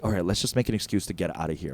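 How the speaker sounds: low, quick undertone; hushed, hurried aside to a buddy; conspiratorial aside. Retold or imitated. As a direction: quietly plot an escape with a friend. Friends quietly plot their escape.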